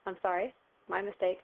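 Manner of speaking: ordinary talk, nothing marked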